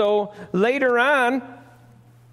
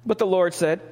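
ordinary talk, nothing marked

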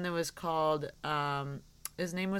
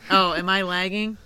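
normal talking voice

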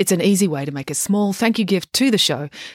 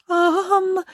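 high, drawn-out hesitant um; nervous. A narrator's reading. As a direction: stall nervously on one high note. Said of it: "said Dad nervously".

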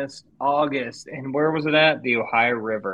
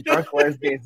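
mock yell; 13 semitones higher, slower than usual. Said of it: "he just kept yelling".

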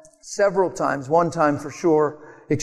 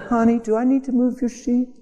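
higher, teasing echo of need; mock reply; 7 semitones higher than usual. Speaker echoes the flight attendant, teasing. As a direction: throw the question back playfully. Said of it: "I'm like".

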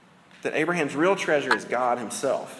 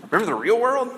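higher, louder teasing jab at the crowd; mock joke; 8 semitones higher, 5 dB louder, faster than usual. Pastor ribbing the congregation, teasing and loud. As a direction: tease the room with a fake question. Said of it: "Just kidding".

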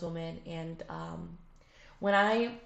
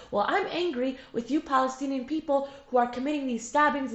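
higher, louder, heated comeback; mock angry retort; 7 semitones higher, 6 dB louder than usual. Israeli girl firing back at the Palestinian speaker, raised heated voice. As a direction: throw the anger right back at her. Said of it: "she'd look at me and be like".

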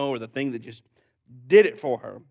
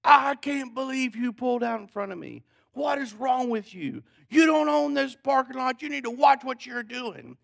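imitating an irate lady, high-pitched; mock angry screaming; 10 semitones higher than usual. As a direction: screech like an outraged stranger in a parking lot. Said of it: "this lady screaming at somebody".

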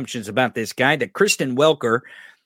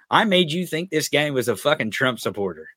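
ordinary talk

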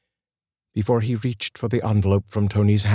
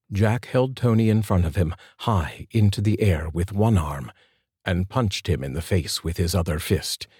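plain reading voice

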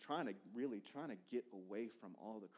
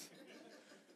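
soft laugh; 13 dB quieter than usual. Real, not acted. Laughing after "Say what.

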